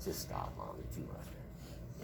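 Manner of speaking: soft, low, hurried words into the phone; hushed private aside. Retold or imitated. Son quietly scolding mom on the phone, soft. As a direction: murmur it just for her ear. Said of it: "it's like".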